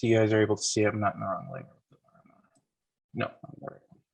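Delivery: ordinary talk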